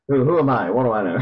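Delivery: laughing it off. Real, not acted. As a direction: chuckle through the words. Self-deprecating joke, laughing.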